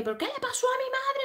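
very high squeaky acted wail; mock upset child. Girl arguing with Sisi about her mother, high-pitched mock outburst. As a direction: imitate a girl demanding answers, way up high.

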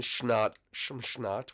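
"schnot" with a sh sound at the start; mispronounced snot. Real, not acted. YouTuber slips while describing his throat, saying "schnot" with sh. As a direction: slur the s into "sh" in "schnot". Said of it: "Why did I say it like that?".

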